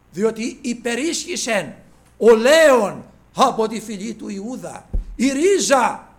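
loud, slow, soaring proclamation of the Lion of Judah; preaching at full force. Acted. Preacher quoting the heavenly voice to the church, loud triumphant preaching. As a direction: ring it out as a victory cry.

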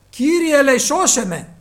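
loud, high cry; desperate cry for help. Acted. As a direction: cry out as if drowning. Peter sinking cries out. (απεγνωσμένη κραυγή, δυνατά)

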